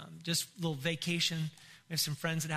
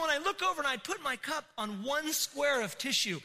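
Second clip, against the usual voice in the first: ordinary talk, nothing marked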